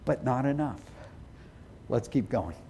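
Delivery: low, soft admission; quiet confession. Shown as a demonstration. Pastor quietly confessing to God that his love falls short. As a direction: murmur it as a private confession.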